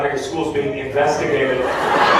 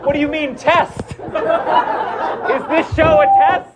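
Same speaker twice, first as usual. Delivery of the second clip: mock plea to dad; 9 semitones higher than usual. Joking plea to absent father.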